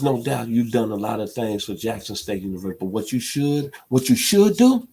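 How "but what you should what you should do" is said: sudden high, loud cry at the coach; emotional outburst. Real, not acted. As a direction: voice jumps up loud and pleading. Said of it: "I'm sorry if I'm getting emotional".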